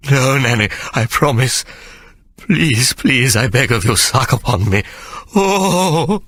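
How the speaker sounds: high, breathless pleading voice; begging. A narrator's reading. Sir Jasper begging Nanny, high breathless pleading.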